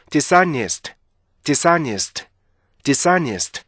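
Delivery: "dishonest" run together as di-SA-nist; smooth "dishonest", no gap after dis; native-like linking. Shown as a demonstration. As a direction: blend "dishonest" into one word. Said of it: "그렇게 해서 읽게 되는 거예요".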